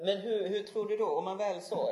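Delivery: usual speaking voice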